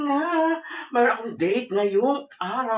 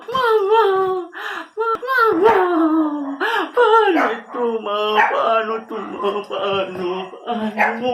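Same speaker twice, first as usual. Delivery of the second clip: crying; 4 semitones higher, slower than usual. Acted. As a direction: bawl to mother, hammed-up and drawn out. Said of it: "ba't ka umiiyak".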